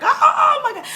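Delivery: high, loud mock wail; re-enacted panicked crying. Cannot get mother on the phone, acted panicked wail. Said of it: "which just intensified".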